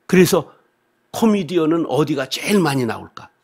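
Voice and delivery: usual speaking voice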